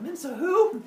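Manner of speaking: high, loud mock innocence; feigned ignorance. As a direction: overplay having no idea who that is. Zeus feigns ignorance when asked.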